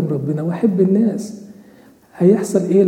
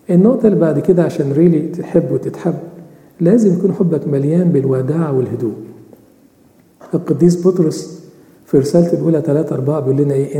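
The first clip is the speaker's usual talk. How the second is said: ordinary delivery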